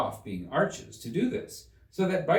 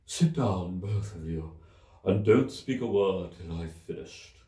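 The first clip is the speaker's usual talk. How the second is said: deep hollow tone; 3 semitones lower than usual. A narrator's reading. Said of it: "in a deep, hollow tone".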